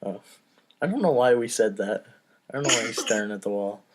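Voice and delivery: normal talking voice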